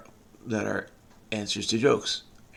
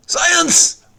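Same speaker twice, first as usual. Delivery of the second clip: very high, loud cry of SCIENCE; mock triumphant exclamation; 7 dB louder than usual. Solver celebrating a science answer, high mock-triumphant shout. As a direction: belt it out like a goofy catchphrase.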